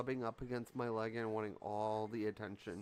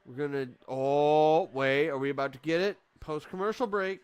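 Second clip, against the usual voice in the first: excited anticipation; 3 semitones higher, 9 dB louder than usual. Real, not acted. Anticipating hot tag.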